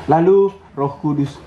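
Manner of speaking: ordinary talk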